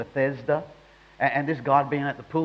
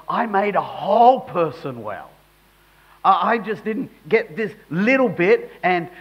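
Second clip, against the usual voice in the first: impassioned Jesus; 6 semitones higher, 9 dB louder than usual. Acted. Preacher voicing Jesus to critics, impassioned and loud. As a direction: plead it like Jesus defending his healing.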